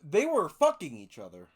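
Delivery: blurted out loud. Real, not acted. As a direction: say it loud and blunt. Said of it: "For lack of better words".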